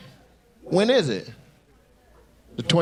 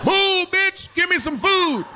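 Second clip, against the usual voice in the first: mock screaming; slower than usual. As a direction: scream it like a starving brat demanding food. Mock voice of a hungry crowd.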